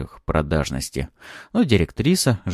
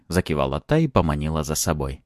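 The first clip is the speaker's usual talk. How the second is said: plain reading voice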